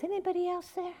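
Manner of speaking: high, small, hushed question; doubtful appeal. Acted. Man on the cliff seeking a second opinion, small high voice. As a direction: ask meekly, hoping for another answer. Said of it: "And the man says".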